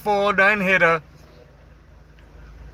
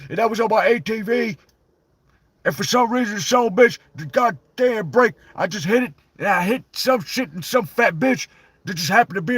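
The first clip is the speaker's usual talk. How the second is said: higher put-on Stone Cold voice; Stone Cold impression; 8 semitones higher than usual. As Stone Cold telling the fan story, a raised put-on voice. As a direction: tell it like Stone Cold himself.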